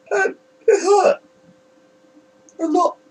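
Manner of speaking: real grief cracks the voice up high; choked up, the words break as he fights tears. Real, not acted. Remembering his late sister, voice cracking with grief. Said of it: "I'm getting a bit emotional".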